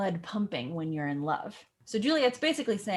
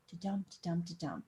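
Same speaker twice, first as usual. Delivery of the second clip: de-dum de-dum de-dum much softer, fading out; softly tapped silent beats; 3 semitones lower, 9 dB quieter than usual. Shown as a demonstration. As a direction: mark the missing feet softly under the breath. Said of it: "feet, meaning six syllables, where she doesn't talk".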